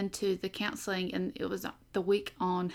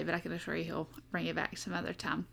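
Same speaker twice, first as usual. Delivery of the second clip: normal talking voice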